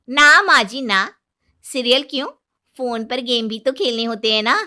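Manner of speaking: loud, mocking answer with a laugh; cheeky daughter-in-law. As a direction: bride teasing her mother-in-law.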